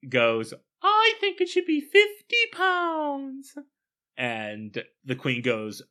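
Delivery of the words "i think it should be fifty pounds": squeaky nurse voice. Retold or imitated. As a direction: pipe it up like a fussy old nurse. Nurse character voiced in retelling.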